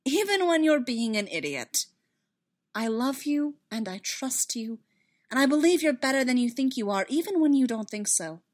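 exasperated but loving; fervent reassurance. Acted. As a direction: insist on your faith in him. Padme fervently reassuring Anakin.

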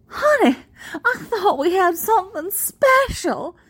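high, hammed-up mock-hurt sweetheart; very loud Southern belle. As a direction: call it out high and theatrically for all to hear. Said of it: "very loudly".

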